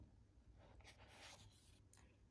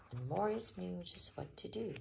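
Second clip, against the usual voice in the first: usual speaking voice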